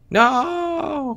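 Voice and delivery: high desperate no; shocked shout. Real, not acted. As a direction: cry out in dismay. Cell gets eaten.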